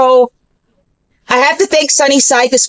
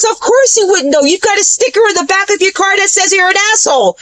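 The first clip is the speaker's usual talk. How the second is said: sneering man; 7 semitones higher than usual. Retold or imitated. Rude man voiced in story.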